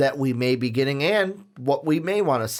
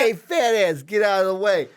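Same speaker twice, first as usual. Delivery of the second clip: higher, louder mobster heckle; mock New York mobster; 6 semitones higher, 3 dB louder than usual. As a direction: shove them aside like a New York wiseguy. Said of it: "Italian mobster from New York".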